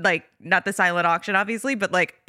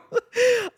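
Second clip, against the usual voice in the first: high giggle; 3 dB louder than usual. Real, not acted. Laughing at her own tears.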